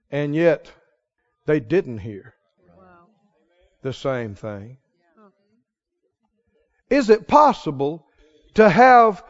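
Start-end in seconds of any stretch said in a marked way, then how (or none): 0.1-4.8 low, soft, with long pauses; hushed emphasis. Real, not acted. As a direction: drop right down and let it sink in. Hushed contrast for effect.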